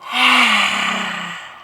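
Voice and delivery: open-mouth sigh, tongue out; lion's breath exhale. Shown as a demonstration. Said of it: "Exhale through the mouth with your tongue sticking out".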